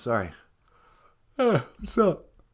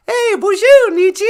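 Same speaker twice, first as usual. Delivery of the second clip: much higher, louder puppet shout; high puppet greeting; 11 dB louder than usual. Pretend. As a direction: call out hello as a squeaky puppet.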